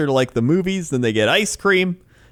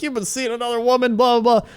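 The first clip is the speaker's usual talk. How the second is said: high-pitched retold accusation, not truly shouted; mock yelling; 8 semitones higher than usual. Wife confronts husband at pharmacy, mock yelling. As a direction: accuse him in a mock yell. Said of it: "they just start yelling".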